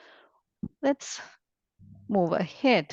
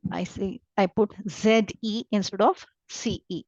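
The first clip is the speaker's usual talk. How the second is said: usual speaking voice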